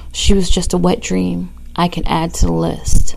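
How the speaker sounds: loud, close breathy poetry reading; poem recital. Acted. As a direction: read it like a spoken-word performance.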